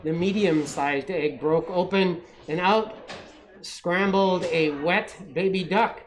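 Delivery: usual speaking voice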